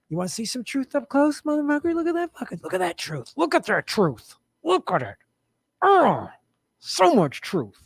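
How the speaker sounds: high, put-on aggressive taunt; hammed-up bully showing off truth; mock tough-guy taunt. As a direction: goad them in a hammed-up street voice.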